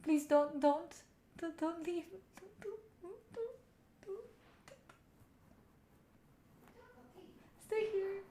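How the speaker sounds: high, soft whimpering mock plea; playful fake sob begging her to stay; mock crying plea. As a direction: beg her to stay in a hammed-up cry. Begging friend not to leave.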